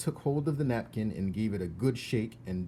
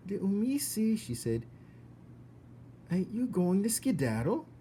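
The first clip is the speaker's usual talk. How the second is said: put-on simple girl; 10 semitones higher than usual. Mig asking the mouse to run, high and simple.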